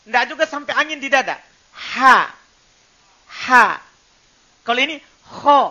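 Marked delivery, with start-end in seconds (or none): 3.3-3.6 ha breathed loud from the middle of the throat. Shown as a demonstration. Teacher showing the middle throat letter, loud breathy demo. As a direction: push ha from mid-throat. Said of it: "ndak bergerak di bawah".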